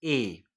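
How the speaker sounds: teacher giving the sound "એ"; sound of letter e. Shown as a demonstration. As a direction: say "એ" short and clean. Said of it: "ઈનો ઉચ્ચાર શું થાય?".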